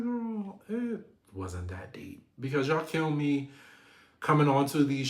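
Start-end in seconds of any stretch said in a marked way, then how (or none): 0.0-2.3 dismissive. Retold or imitated. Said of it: "I'm just like".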